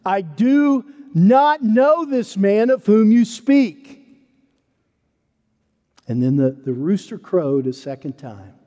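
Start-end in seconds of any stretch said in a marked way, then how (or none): 0.0-3.9 slow, loud, higher furious oath; goes nuclear. Acted. Peter cursing himself before the crowd, slow furious shout. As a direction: spit out every word as a curse. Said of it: "he goes nuclear".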